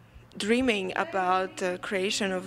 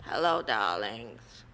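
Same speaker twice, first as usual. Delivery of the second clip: much lower, sultry diva greeting; Marlene Dietrich voice; 10 semitones lower than usual. Acted. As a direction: purr it like a vintage film star. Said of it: "this is my puppet, Marlena Dietrich".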